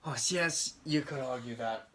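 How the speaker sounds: ordinary delivery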